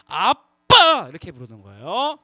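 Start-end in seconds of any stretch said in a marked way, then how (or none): 0.1-1.1 아주 크고 길게 늘여 우는 듯 '아빠!'; 짜증 폭발하듯 길게 외쳐. Shown as a demonstration. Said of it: "화가 났을 때는 어떻게 부르냐면". (아빠에게 화가 나서 길게 울부짖듯 부름)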